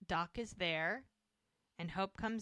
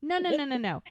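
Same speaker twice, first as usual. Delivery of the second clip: higher, louder insistent no's; louder mock protest against Doc's excuses; mock outraged protest; 4 semitones higher, 6 dB louder than usual. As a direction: shut him down with a string of no's. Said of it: "And she's like".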